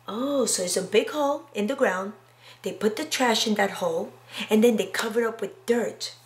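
ordinary delivery

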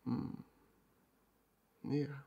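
ordinary talk, nothing marked

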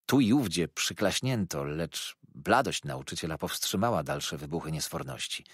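ordinary delivery

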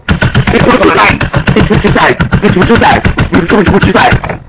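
high-pitched repeated mock challenge; hammed-up, high-pitched confrontation. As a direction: retell a challenge in a high, agitated voice. Retelling her confrontation, repeated challenge.

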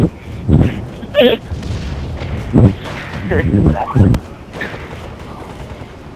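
long wheezing laugh at the joke; breathless laughing fit. Real, not acted. Laughing at a friend's crude joke over voice chat, breathless giggles. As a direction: giggle helplessly, nearly silent.

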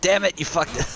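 high laughing jeer; mock them for the mistake; teasing. Real, not acted.

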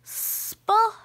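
ordinary delivery, nothing marked

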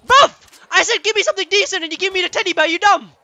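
high, fast indignant scolding; exasperated rant. Real, not acted. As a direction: tell the game off, outraged. Berating game over bad weapon.

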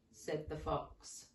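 ordinary delivery

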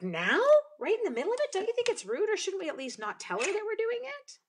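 high-pitched mock-scandalized questioning; imitating Laura's objection. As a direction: put on a high voice, protesting the rudeness. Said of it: "Laura's like".

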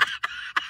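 quick voiceless chuckle; breathy laugh. Real, not acted. Pastor laughing at his own botched verse.

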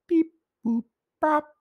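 high robot-like beeps; silly beeping voice. Pretend. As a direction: chirp the counting sounds playfully. Counting decimal moves in silly voice.

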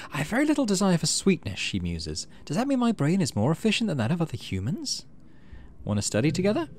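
a hint of a bookish kid thinking aloud; curious Tang.

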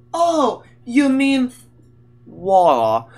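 loud, exaggerated British dawning realisation; hammed-up British correction, t dropped in water; mock British accent. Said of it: "Sono britannici di merda".

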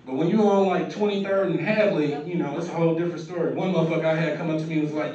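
normal talking voice

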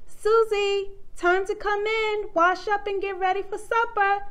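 high-pitched mom's call; loud voice. A narrator's reading. Mom calls Susie in for supper, loud voice. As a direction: call a child in from the yard. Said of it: "Susie's mom said in a loud voice".

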